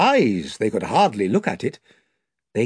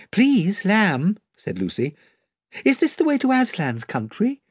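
put-on higher, bright girl's voice asking; Lucy politely asks the lamb the way, higher girl voice; young girl Lucy, eager; 7 semitones higher than usual. A narrator's reading.

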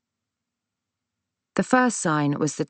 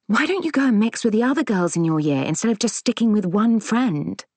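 put-on higher-pitched mother urging her child; nagging mother; 5 semitones higher than usual. A narrator's reading. Mother asking her shy daughter to mix more, nagging. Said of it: "My mother was always telling me".

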